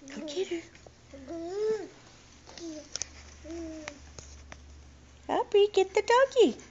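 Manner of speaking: put-on high, sing-song voice for a toddler; reading to a toddler. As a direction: coax a little one, bright and lilting. Mother coaxing toddler to dog.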